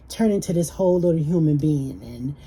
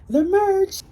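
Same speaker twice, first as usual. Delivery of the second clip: playful squeal; 3 dB louder than usual. Real, not acted. Teasing the giveaway prize.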